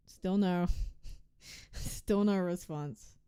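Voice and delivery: slow, laughing through the words; slow, bitter laugh at getting no reply. Real, not acted. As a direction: laugh it out in disbelief.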